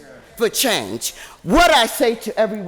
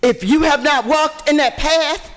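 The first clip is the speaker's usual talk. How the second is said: loud preaching at a council meeting; fiery preaching; 5 dB louder than usual. Real, not acted. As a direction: preach it to the council, full force. Impassioned rally speech.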